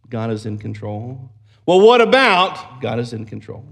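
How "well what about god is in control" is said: a sharp, much higher and louder challenge; doubting challenge. Retold or imitated. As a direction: throw the doubter's question out loud. Doubter's voice questions God's control.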